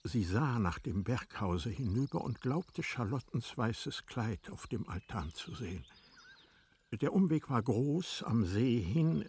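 ordinary talk, nothing marked

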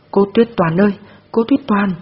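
calling after her, wailing; sobbing cry. A narrator's reading. Girl in the police car cries out for Tuyết Toàn. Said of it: "òa khóc nức nở".

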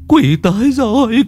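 high, loud panicked babble; terrified stammer. Acted. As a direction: gibber in terror, pitched high. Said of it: "miệng nó lắp bắp".

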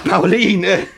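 high loud call of her name; joyful cry. Acted. Alexei cries her name.

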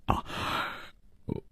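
halting, breathless and faint, with long pauses; weak stammer. Acted. Exhausted guest haltingly asks to be taken in, weak stammer. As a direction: stammer it out softly, voice nearly giving out. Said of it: "他结结巴巴地想说明来意".

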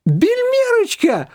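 very high, slow, savoring the word; ecstatic. A narrator's reading. Shushana delighted repeating the nickname. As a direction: relish the new name. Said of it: "от восторга".

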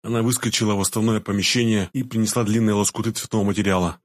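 usual speaking voice

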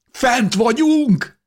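high, slow, ringing cry of joy; exultant shout. A narrator's reading. Breaking through snow, shouting up to the stars, overjoyed. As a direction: shout it out stretching every word.

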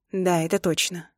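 plain reading voice

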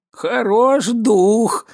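slow, high-pitched exclamation; crying out. A narrator's reading. Bixiou scoffing at his drinking companion's boast, crying out. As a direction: draw both words out in a cry. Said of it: "вскрикнул Бесиу".

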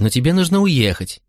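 acted anxious insistence; urgent insistence. A narrator's reading. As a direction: press the point, lifting "нужно" high. Mother insists more urgently.

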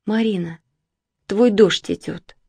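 sleepy voice. Acted. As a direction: say it half asleep. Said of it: "сказала она сонным голосом".

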